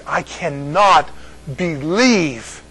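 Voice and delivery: CANNOT BELIEVE drawn out slowly; emphatic disbelief. Real, not acted. Pastor marvels at the book on salvation, "cannot believe" slow and emphatic. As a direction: spread out "cannot believe", pausing before "believe".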